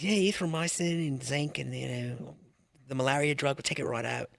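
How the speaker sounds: put-on botched British accent that slips toward Australian. Retrying British neighbor's malaria drug advice, shaky British accent. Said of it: "he's British".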